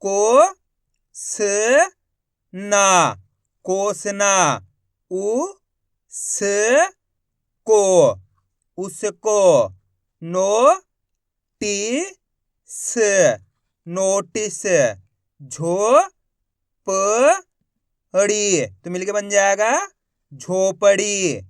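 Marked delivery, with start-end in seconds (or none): 0.0-18.6 long drawn syllables with pauses between; stretched spelling drill; syllable-by-syllable sounding out. Shown as a demonstration. Teacher sounding out words by syllable. 20.3-21.4 long drawn syllables with pauses between; stretched spelling drill; syllable-by-syllable sounding out. Shown as a demonstration. Teacher sounding out words by syllable.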